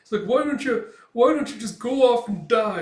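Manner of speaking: high, loud upset outburst; worked-up little character yelling; tearful scolding. Retold or imitated. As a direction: berate a friend through mock tears. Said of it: "tears streaming down Puck's face".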